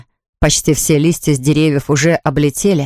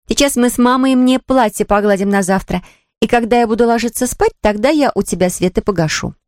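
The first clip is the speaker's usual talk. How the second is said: higher-pitched sister making a deal; older sister; 5 semitones higher than usual. Acted. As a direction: reassure a little brother as his sister. (старшая сестра, голос повыше)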